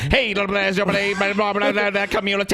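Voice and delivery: fast mock auctioneer patter; auctioneer babble. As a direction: rattle off nonsense like an auctioneer. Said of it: "He's like an auctioneer".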